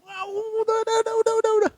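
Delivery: high, fast pleading udah over and over; squeaky backing-down plea; pleading driver. Retold or imitated. Frightened driver begging the preacher to stop.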